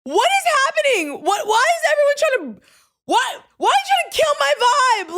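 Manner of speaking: high, loud disbelieving cry; exasperated outburst. Retold or imitated. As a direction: protest at everyone killing the mood. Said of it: "I'm like".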